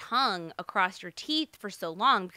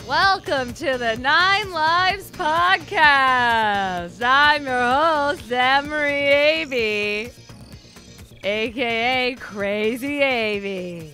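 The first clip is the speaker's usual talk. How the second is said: slow, loud, drawn-out show intro; podcast announcer; 3 semitones higher, 9 dB louder, much slower than usual. Acted. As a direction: announce it big and slow like a host.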